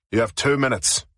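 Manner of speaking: a light hint of an Irish brogue; lightly voiced Irish sergeant, curt. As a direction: sergeant giving her a phone call, light Irish brogue. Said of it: "he said in a heavy Irish brogue".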